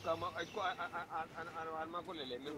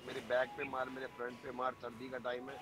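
mocking the guy; 7 semitones lower than usual. Friend mocks Panori's creepy wish, low and teasing. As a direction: lower voice mocking a creepy guy's request. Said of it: "वो तो कह रहा है".

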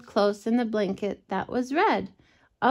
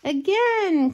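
high eager cry; excited cry; 9 semitones higher, 4 dB louder than usual. Acted. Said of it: "cried Sam".

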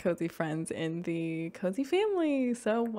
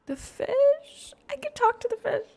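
much higher, louder thrilled squeal; excited squeal; 7 dB louder than usual. Real, not acted. Gamer thrilled she can talk to the fish, high squeal. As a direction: squeal with sheer delight.

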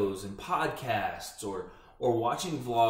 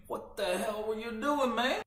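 higher, slow teasing question; quizzical viewer voice; 12 semitones higher, slower than usual. Pretend. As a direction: play a baffled viewer. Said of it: "or if you think".

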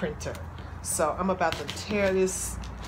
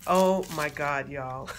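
ordinary delivery

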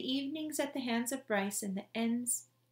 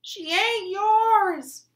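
very high, loud, slow scream; furious scream; 11 semitones higher, 15 dB louder, much slower than usual. A narrator's reading. Son screaming at his father taking the body, furious. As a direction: scream it at him with everything. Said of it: "Bryce screamed".

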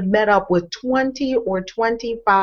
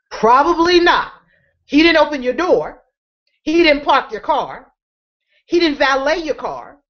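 preaching build; 6 semitones higher than usual. Acted. Speaker to audience about Trump, rising preaching voice. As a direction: drive it home like a preacher.

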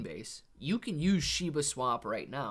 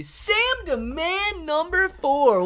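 much higher and slow, drawn out; sing-song greeting; 9 dB louder, slower than usual. Pretend. Greeting viewer Sam Demand, high slow playful call-out. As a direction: call the name out like a playful announcer.

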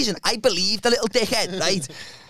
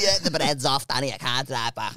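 low, soft complaining voice; Chris; 8 semitones lower, 6 dB quieter than usual. Retold or imitated. Friend blaming bad bread for not driving, low soft voice. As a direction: murmur the excuse down low. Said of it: "it's just how he speaks".

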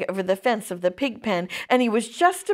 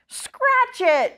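mild acted yell; 13 semitones higher than usual. A narrator's reading. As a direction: yell it lightly, pitched way up. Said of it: "yelled Avery".

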